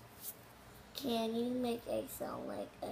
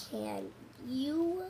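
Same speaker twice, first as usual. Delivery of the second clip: normal talking voice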